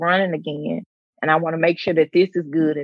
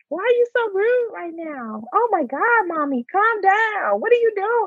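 high-pitched mock child voice; imitating kids; 13 semitones higher than usual. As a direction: squeal it like an exasperated little kid. Twins' scolding of mommy, voiced.

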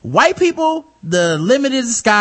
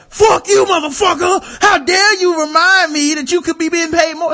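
mock furious fan; 8 semitones higher than usual. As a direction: scream it like an enraged fan cursing a player. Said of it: "And they go".

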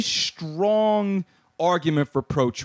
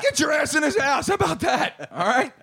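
yelling across the room; 7 semitones higher than usual. Retold or imitated. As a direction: holler it at a mouthy kid. Parent yelling back at the daughter.

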